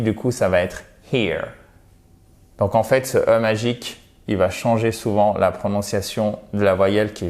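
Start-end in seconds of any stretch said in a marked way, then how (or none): 1.1-1.5 here with a long EE; English here. Shown as a demonstration. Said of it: "Puisque vous avez le E magique". (here avec un I long)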